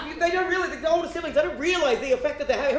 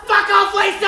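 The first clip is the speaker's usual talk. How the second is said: higher, louder mock crowd yell; 8 semitones higher, 7 dB louder than usual. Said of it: "the entire audience just yelled".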